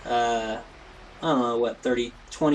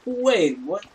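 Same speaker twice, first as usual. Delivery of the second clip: high-pitched, startled double take; mock surprise; 7 semitones higher than usual. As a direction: replay your baffled disbelief. Reacts to sudden jump in views.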